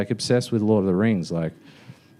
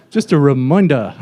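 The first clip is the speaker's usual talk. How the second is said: louder mock New Zealand announcement; New Zealand accent; 2 semitones higher, 5 dB louder than usual. Cabin crew reminding passengers, mock New Zealand accent. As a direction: exaggerate the New Zealand vowels. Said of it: "the New Zealand accent".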